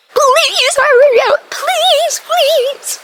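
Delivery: high, loud, slow begging; reenacting begging mom to hurry, high and loud; desperate pleading. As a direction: drag out each please in panic. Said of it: "I'm about to reenact it".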